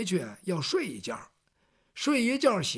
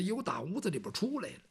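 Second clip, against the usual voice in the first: ordinary delivery, nothing marked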